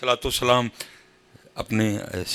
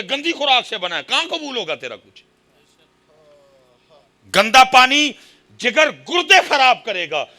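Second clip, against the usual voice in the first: loud, high-pitched exhortation; full-force preaching; 13 semitones higher, 14 dB louder than usual. Real, not acted. Preacher warns the congregation about unclean earnings, impassioned shout. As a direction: thunder the warning at the congregation.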